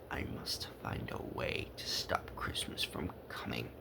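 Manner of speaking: low, soft scheming whisper; growling whisper. A narrator's reading. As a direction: plot it under your breath. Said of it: "he growled".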